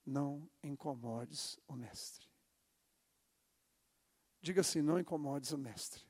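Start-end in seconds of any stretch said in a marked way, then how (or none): 0.0-2.3 hushed. Shown as a demonstration. Preacher models line for congregation.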